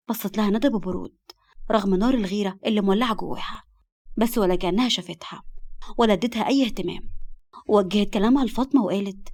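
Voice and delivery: usual speaking voice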